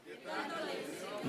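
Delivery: whispered, breathy repeat of the question; hushed aside. Acted. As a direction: drop to a whisper as if to yourself.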